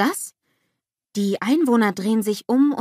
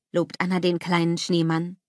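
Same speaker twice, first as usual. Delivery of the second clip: ordinary delivery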